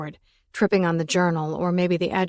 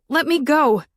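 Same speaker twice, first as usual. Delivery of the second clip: high, loud cry to be released; woman struggles in his grip, crying out; loud plea; 8 semitones higher, 6 dB louder than usual. A narrator's reading. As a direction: cry out to be freed. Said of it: "I wrinkled".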